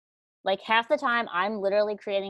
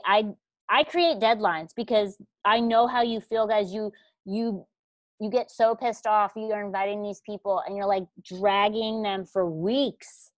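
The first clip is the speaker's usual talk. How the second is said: ordinary delivery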